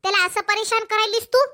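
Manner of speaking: ordinary talk, nothing marked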